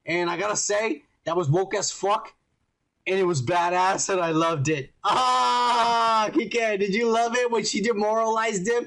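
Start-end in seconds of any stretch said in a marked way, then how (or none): 5.0-8.7 excited outburst. Real, not acted.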